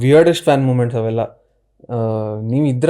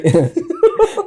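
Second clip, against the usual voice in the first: rising laugh. Real, not acted. Laughs at fan's confirmation.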